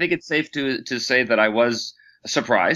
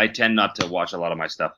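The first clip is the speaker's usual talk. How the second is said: usual speaking voice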